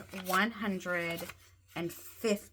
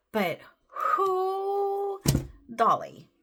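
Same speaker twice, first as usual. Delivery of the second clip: excited whoop; 12 semitones higher, 5 dB louder, much slower than usual. Real, not acted. Reacting to the stuffed binder.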